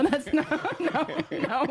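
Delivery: slow, giggling no; real laughter; laughing. Real, not acted. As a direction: laugh off the failed accent. Laughing at own failed accent.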